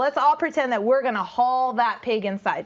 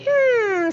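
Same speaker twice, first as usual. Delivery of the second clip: higher, louder pondering hmm; thinking hum; 11 semitones higher, 5 dB louder than usual. Acted. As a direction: hum over the idea. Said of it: "said the fox to him".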